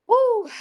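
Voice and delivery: high, loud excited whoop; excited woo. Real, not acted. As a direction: let out a real whoop of joy. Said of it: "starting to sweat".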